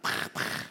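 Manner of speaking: unvoiced mock striking sound; rock strike sound. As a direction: puff out two strikes on the rock. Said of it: "It's probably the sound it made".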